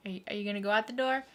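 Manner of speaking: ordinary talk, nothing marked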